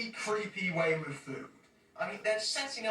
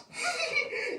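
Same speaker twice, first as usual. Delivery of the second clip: high burst of laughter. Real, not acted. Laughing at the name.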